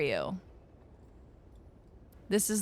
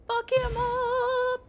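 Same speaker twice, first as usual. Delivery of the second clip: much higher, slow, stretched-out put-on voice; hammed-up battle cry; much slower than usual. Streamer ordering her character to attack, hammed-up drawl.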